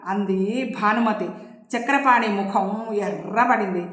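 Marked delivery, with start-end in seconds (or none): none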